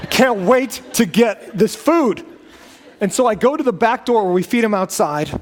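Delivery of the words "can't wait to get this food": giddy dog. Retold or imitated. Pastor voicing his spinning dog, slow and giddy. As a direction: gush like a dog spinning for food. Dog doing jump spins.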